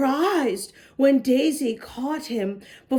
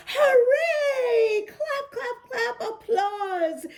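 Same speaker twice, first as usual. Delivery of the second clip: high, excited cheer with laughter; cheering kids; 9 semitones higher, slower than usual. Acted. Kids cheering as Zeke surfaces, high and excited. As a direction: whoop it up like delighted children.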